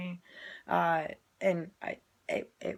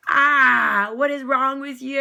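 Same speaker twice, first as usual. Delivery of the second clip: loud higher mock yell; hammed-up angry shout at the teacher; mock outburst; 6 semitones higher, 15 dB louder, slower than usual. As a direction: blow up in pretend anger. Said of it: "Do I just be like".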